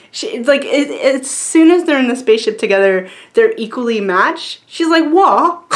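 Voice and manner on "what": higher, rising British "What?"; "What?" in a mock British accent, going up at the end; confused UK accent. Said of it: "they talk in U K accents".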